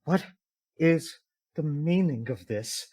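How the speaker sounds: soft, slow, measured demand; indignant demand. Acted. Tiefling woman confronts her man, quietly indignant. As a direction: draw the question out quietly, affronted. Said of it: "just goes".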